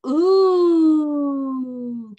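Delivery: loud rising ooh of delight. Shown as a demonstration. Teacher showing kids the circus food sound, a loud delighted ooh. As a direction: ooh like you can't wait to taste it. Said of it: "can you make that sound".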